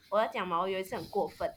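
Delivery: ordinary talk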